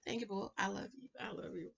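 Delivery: soft, tender thanks to a fan. Real, not acted. Thanking a viewer who left a kind comment, softly. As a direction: murmur your thanks back gently.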